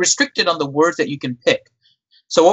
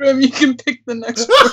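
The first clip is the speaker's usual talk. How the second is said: laughing; 8 semitones higher than usual. Real, not acted. Still laughing after the typo.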